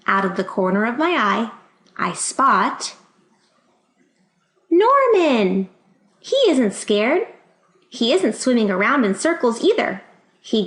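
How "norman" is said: surprised. Acted. Spots fish unexpectedly.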